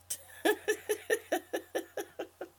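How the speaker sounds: high, rapid string of chuckles; laughing. Real, not acted. Coach reading viewers' chat, high tapering giggle. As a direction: giggle helplessly, loud then tapering.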